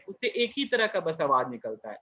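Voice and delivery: ordinary talk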